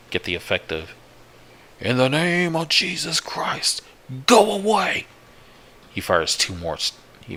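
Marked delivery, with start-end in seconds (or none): 1.8-5.1 desperate shout. Acted. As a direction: cry it out to drive something away. Acted shout into the woods.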